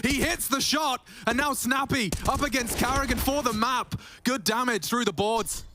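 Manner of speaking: high, thrilled play-by-play; excited caster. Real, not acted. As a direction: ride the hype of the shot. Caster hypes clutch shot.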